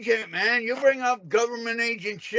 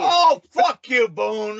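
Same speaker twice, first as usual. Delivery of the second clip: exasperated outburst; 5 dB louder, much slower than usual. Real, not acted. Caller cutting in on the other man mid-argument, exasperated. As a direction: swear back in loud exasperation.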